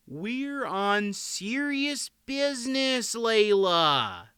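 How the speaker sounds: slow, high, drawn-out protest; high, slow, put-upon husband; protesting king. A narrator's reading. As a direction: stretch it out to put off a wife. King protests to fussing wife.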